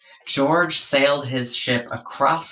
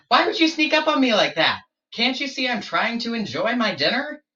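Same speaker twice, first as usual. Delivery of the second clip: much higher, quick, indignant creature voice; annoyed platypus; 11 semitones higher, faster than usual. Acted. Platypus disturbed at dinner, high indignant voice. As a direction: snap back high and fast at the intruder.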